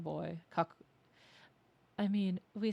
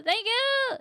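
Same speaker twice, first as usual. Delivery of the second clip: even higher, loud thank you; excited thanks again; 7 dB louder than usual. Real, not acted.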